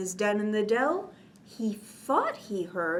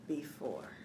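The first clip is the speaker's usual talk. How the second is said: fading; 7 semitones lower, 10 dB quieter than usual. A narrator's reading. Sleepy bear fading out.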